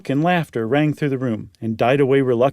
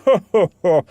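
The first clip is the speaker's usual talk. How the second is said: short, louder relieved laugh; Meagle's laugh; 4 semitones higher, 6 dB louder than usual. Acted. Meagle laughing off the candle falling, relieved laugh. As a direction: laugh off the scare. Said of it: "Then Meagle laughed".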